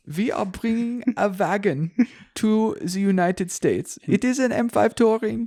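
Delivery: put-on German accent. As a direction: announce it like a German exec. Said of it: "My German accent sucks".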